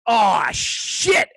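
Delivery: the blunder sinks in; oh-no panic. Retold or imitated. Bodyguard after insulting the star, panicked. Realising his mistake.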